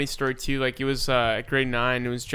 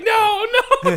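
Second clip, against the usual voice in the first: very high, loud laughing whine; embarrassed protest; 8 dB louder than usual. Retold or imitated. Boy protests holding teacher's hand, high loud laughing whine. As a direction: squirm away in mock horror.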